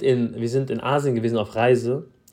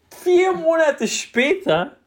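high, drawn-out storyteller announcement; dramatic reveal; 5 dB louder, much slower than usual. Retold or imitated. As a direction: stretch it out slowly for suspense. (dramatisch gedehnt)

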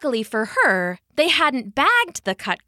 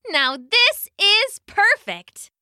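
high, slow, savoring delight; slow exclamation of pure satisfaction; 9 semitones higher, 4 dB louder, slower than usual. A narrator's reading. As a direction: relish each word, pitched up. Bunny delighted by untouched grass.

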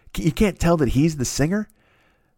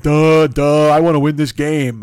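loud, dopey dumb-guy voice; 2 semitones higher, 7 dB louder, slower than usual. Retold or imitated. Contestant answering judge in a dopey loud voice. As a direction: play it slow-witted and loud. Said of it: "And he's like".

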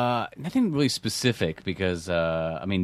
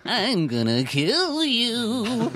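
comical high, drawn-out death threat; unconvincing threat; much slower than usual. Shown as a demonstration. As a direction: threaten without real anger, high and slow. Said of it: "it doesn't come out that way".

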